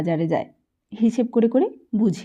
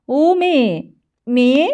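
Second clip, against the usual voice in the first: loud, high call from upstairs; an old man hollering for her; calling the daughter-in-law down loudly; 3 semitones higher, 5 dB louder than usual. A narrator's reading. Old grandfather calls daughter-in-law.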